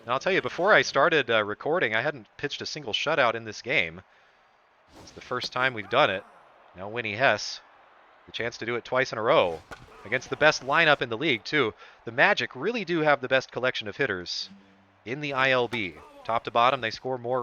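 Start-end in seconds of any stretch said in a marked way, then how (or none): none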